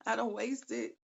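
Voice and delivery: laughing through the words; words broken by a real laugh. Real, not acted. Laughs at own cheat day.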